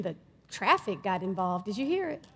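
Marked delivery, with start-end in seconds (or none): none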